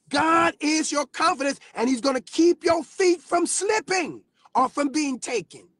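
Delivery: high, loud calls to the congregation; full-force preaching. Real, not acted. Preacher calling out members by name, shouting high and loud. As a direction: whip the church up by name.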